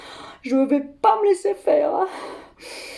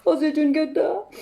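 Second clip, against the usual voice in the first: ordinary talk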